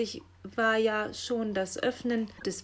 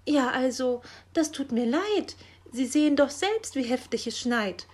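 higher, louder voice of excuse; defensive; 5 semitones higher, 5 dB louder than usual. A narrator's reading. Magpie excusing herself because of the snow, defensive pleading. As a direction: plead your case, flustered. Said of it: "verteidigte sich Frau Elster".

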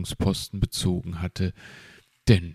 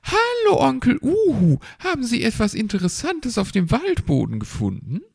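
put-on cheerful children; 5 dB louder, faster than usual. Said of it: "ein Chor aus fröhlichen Kinderstimmen".

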